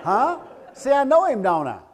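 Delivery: loud, high-pitched teasing; mock triumph. Speaker teasing Tim about tickets, loud high mock triumph. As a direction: crow it playfully over the laughter.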